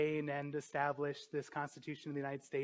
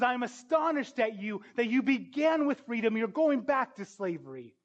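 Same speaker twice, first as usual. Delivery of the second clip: insistent, higher-pitched quoted rebuke; adamant; 6 semitones higher, 4 dB louder than usual. Acted. Paul rebuking believers going back to slavery, adamant. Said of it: "Paul so adamantly in Galatians says".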